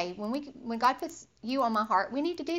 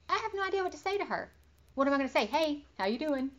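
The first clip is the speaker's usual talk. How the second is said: higher, fast, flustered self-quote; at a loss; 5 semitones higher, faster than usual. Retold or imitated. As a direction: rattle off your panic about what to text. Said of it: "and I'm like".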